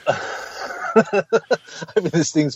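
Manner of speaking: slow, stretched words through giggles; can barely read it for laughing; laughing while reading. Real, not acted. Reading a rude letter.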